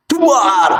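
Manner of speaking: sudden high "duar" thunder boom; imitated thunderclap. Storyteller imitating lightning hitting the mosque, explosive thunderclap. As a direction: burst out like lightning striking. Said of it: "tiba-tiba ada suara petir menyambar".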